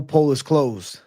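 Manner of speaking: ordinary talk, nothing marked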